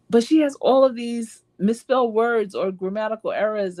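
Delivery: high-pitched, incredulous protest; mock protest, pitched well up; retold objection. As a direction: act out a baffled complaint. Retelling own objection to the grammar.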